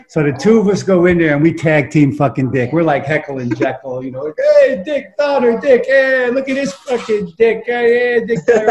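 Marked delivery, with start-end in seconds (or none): 4.6-8.7 high, silly cartoon-duo razzing; stupid goofy heckling. Two buddies tag-teaming the director at audition, goofy. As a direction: tease him in a high goofball voice. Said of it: "doing, like, all stupid, goofy shit".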